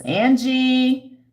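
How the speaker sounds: high, loud call of a name; excited greeting. Real, not acted. Vlogger greets a fan in chat, high and loud. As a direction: sing out a viewer's name, thrilled.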